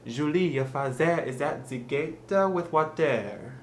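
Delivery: slow, careful French-accented English; deliberate learner's French accent. Shown as a demonstration. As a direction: demonstrate a thick French accent word by word. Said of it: "to say it with a French accent".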